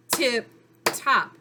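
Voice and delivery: "tip top" in two separated, punched beats; clapped syllables. Shown as a demonstration. Teacher showing two syllables, "tip top" clapped apart. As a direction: split "tip top" with a pause, one clap each. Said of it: "clap out the syllables".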